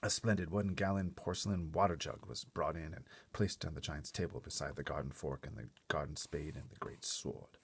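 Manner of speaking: usual speaking voice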